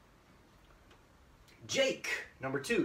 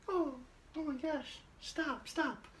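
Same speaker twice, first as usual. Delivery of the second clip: put-on flustered girl; 11 semitones higher, 5 dB quieter than usual. Yumi flustered by confession.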